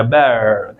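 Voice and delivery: "bear" low, open e into schwa; correct English pronunciation. Shown as a demonstration. Teacher repeats the target sound, correct pronunciation. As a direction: draw "bear" down on an open vowel. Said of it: "यही वाला sound आएगा".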